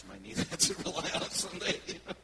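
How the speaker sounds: laughing. Real, not acted. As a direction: chuckle out the punchline. Joking about brain damage.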